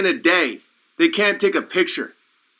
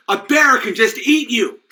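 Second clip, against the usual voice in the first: ordinary talk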